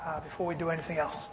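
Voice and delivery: ordinary talk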